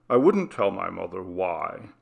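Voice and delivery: ordinary delivery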